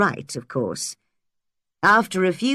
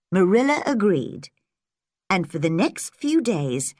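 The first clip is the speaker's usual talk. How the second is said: usual speaking voice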